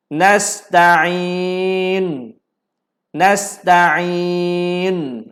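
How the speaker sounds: Nasta'in with the lips pushed forward on the final ain; rounded lips ishmam. Shown as a demonstration. Ustaz shows the class how to pout on nasta'in. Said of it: "Macam mana muncung".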